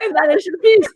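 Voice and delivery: high, loud, breaking into laughter; can't get the words out for laughing; laughing through it. Real, not acted. Host cracking up while picturing a guest talking like a British lady.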